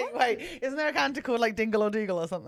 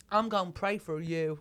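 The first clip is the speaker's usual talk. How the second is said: mock pious Texan promise; Texan accent; 4 semitones lower than usual.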